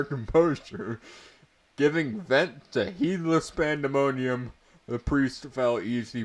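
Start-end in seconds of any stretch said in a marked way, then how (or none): none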